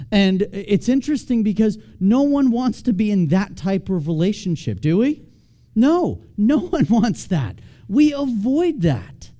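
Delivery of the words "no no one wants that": ordinary talk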